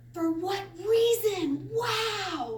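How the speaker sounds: high, drawn-out cry of amazed triumph; victorious exclamation. Shown as a demonstration. Teacher voicing Jesus on the cross, victorious exclamation. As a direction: call it out high, as a sudden revelation. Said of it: "more like a victorious exclamation".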